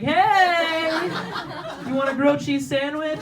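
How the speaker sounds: high-pitched mock mom voice; mom calling upstairs. Mom interrupting her kid to offer food, high singsong call. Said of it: "your mom's downstairs dancing to Ellen".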